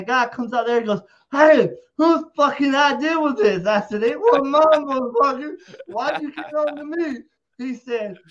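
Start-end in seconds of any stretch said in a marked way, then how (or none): 1.3-3.7 louder, higher angry demand; louder, higher angry yell; retold angry shout. Security guy angrily confronting him. 4.0-7.2 louder, higher defensive yell back; louder, higher indignant shout; retold angry retort. Shouting back at security guy.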